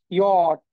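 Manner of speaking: "ইয়ট" as a loud, drawn-out "yot"; German letter J. Shown as a demonstration. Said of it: "জে এর যে উচ্চারণ সেটা হচ্ছে ইয়ট".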